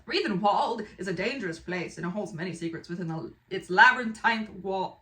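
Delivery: lively storyteller read-aloud; dramatic blurb reading. Acted. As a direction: read it like an exciting trailer.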